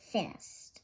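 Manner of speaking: plain reading voice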